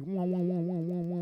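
sung wah wah like a wobbling whammy bar; tremolo wah imitation. Describing his Gretsch's Bigsby, a vocal wah wah imitation. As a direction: mimic the guitar tremolo with your voice.

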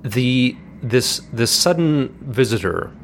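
ordinary talk, nothing marked

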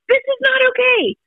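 high, insistent upset protest. Retold or imitated. Mom to calm husband over injured son, high upset protest. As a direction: near panic, pitch flying up. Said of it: "I'm like".

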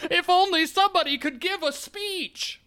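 very high-pitched mock plea; wishful villager. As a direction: squeak out a hammed-up villager's wish. Joins the pleading villager bit.

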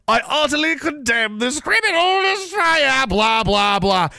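high, drawn-out parody of condemnation; hammed-up official indignation, slow and high; mock outraged official. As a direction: declaim it in an inflated high voice, trailing into blahs. Mocks an official's stock condemnation.

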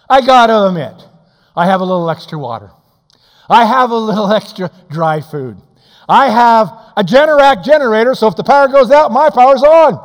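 loud, high preacher's cry; full-force revival sermon; fiery preaching. Acted. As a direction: preach it out to the back pews.

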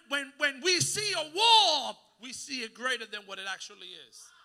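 ordinary talk

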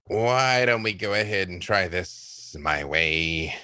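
slow, low-voiced suggestion; Freely. Character standing up to offer his way, low and slow. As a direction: drop low and take your time. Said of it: "Freely just stands up and he's".

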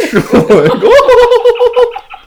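high raspy giggling fit; Marge Simpson laugh. Real, not acted. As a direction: cackle up high like a cartoon mom. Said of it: "now she sounds like Marge Simpson".

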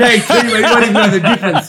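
amused grin in the voice; laughing. Real, not acted. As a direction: laugh it out as you tease. Joking about a tag team.

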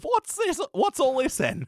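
high-pitched comic British voice; mock British accent. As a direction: ask nosily in a hammed-up British accent. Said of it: "just the accent we use".

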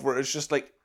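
ordinary delivery

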